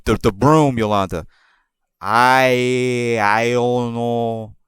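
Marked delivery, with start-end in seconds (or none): 2.0-4.6 slow, halting coworker voice; lightly imitated coworker, very slow; mock broken English. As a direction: drag it out like she can't find the words. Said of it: "It pretty much goes like this".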